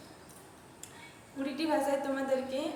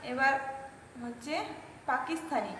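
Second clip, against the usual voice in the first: normal talking voice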